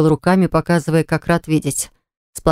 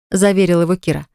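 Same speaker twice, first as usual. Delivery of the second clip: ordinary delivery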